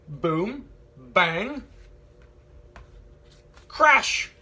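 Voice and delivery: building from normal to loud crash. A narrator's reading. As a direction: start steady, then slam the crash. Bear banging drums.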